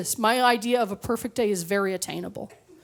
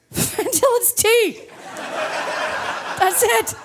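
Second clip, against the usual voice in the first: mock triumphant; 10 semitones higher, slower than usual. Mock congratulation to audience.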